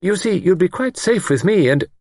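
high-pitched, eager reassurance; anxious spinster. A narrator's reading. Older woman offers safe refuge, anxiously insistent. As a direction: press the offer, cut off midway.

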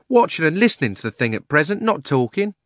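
loud, high London cabby; cockney cabby. Acted. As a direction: shut him up, cabby-style. Cabby continues.

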